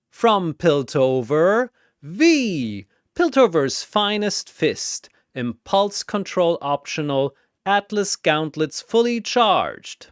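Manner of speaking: boxing announcer calling fighters; arena announcer. Acted. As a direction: hype each fighter like a ring announcer.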